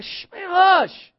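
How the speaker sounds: high, drawn-out shushing; stern hushing. Acted. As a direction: hush someone forcefully. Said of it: "And then they sternly".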